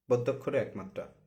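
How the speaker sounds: usual speaking voice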